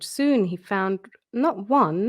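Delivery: plain reading voice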